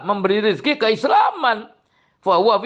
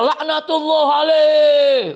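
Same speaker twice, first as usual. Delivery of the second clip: mocking how the Shia curse Abu Bakar; 8 semitones higher, slower than usual. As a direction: parody their cursing. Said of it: "Abu Bakar ngomongnya".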